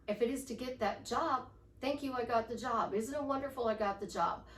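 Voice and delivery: ordinary delivery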